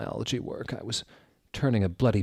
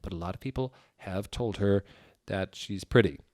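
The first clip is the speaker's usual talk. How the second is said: ordinary delivery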